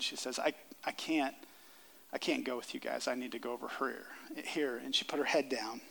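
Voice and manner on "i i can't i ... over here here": low, soft, retold timid voice; retold timidity. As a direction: quietly imitate someone shrinking back. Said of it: "she became very timid".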